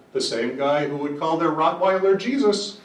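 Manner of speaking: mock parrot retort, building to the last word; parrot's punchline. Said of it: "the parrot says".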